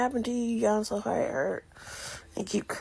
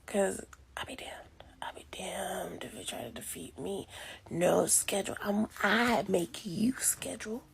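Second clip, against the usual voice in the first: hushed defiant whisper; 9 dB quieter than usual. Real, not acted. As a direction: whisper it like a threat. Whispering threats at her schedule.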